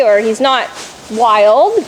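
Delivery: ordinary talk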